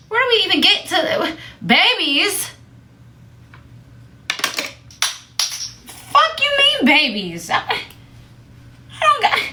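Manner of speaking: high-pitched comic outrage; incredulous rant. Retold or imitated. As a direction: rant in high, exasperated disbelief. Acting out disbelief at babies remark.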